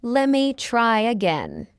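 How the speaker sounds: slow, drawn-out "Let me try again"; slow model. Shown as a demonstration. Teacher models the phrase for learners first, slowly. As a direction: stretch each word of "Let me try again". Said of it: "fast English is not difficult".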